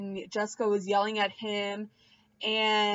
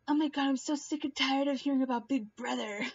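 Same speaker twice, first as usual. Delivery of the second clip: higher, fast fed-up put-on voice; bored baby voice; 4 semitones higher, 3 dB quieter, faster than usual. Pregnant mom voicing her unborn baby, higher fast fed-up voice. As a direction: sigh it out like you're sick of it. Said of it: "she's probably like".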